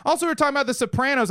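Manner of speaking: usual speaking voice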